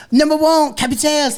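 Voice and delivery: put-on very high, loud French-accented cheer. Fake French player cheering his team, loud French accent. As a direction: crow it in a hammed-up French accent. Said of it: "I'm just like".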